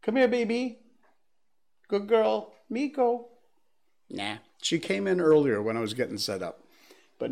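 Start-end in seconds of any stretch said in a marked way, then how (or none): 0.0-3.3 calling the dog, high and loud; calling from far away. Real, not acted. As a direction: call out sweetly to a pet. Calling the dog from another room.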